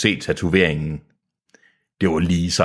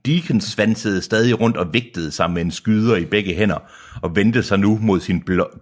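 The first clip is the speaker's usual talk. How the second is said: usual speaking voice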